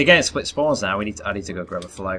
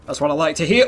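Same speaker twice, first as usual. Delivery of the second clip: ordinary talk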